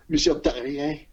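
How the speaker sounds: mock commentator's French accent on the name; super French accent. Imitating commentators saying a French name, exaggerated French accent. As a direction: put on airs of Frenchness. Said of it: "they gotta say it funny".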